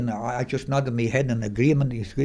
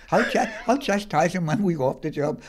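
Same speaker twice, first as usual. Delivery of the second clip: high, wheezing laughter; laughing while talking; 12 semitones higher than usual. Real, not acted. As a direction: barely get the words out laughing. Laughs through the punchline.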